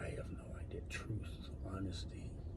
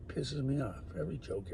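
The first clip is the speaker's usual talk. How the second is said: ordinary delivery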